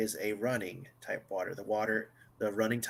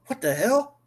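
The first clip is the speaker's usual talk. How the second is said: higher, louder in-character startle; startled outburst; 12 semitones higher, 10 dB louder than usual. Acted. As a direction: blurt it out loud and high, as a disturbed character. Game master voices a disturbed thing.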